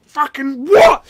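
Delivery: high, explosive shout; outraged yell. Retold or imitated. Wrestler hearing another wrong favourite character, yelling in outrage. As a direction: burst out in loud disbelief.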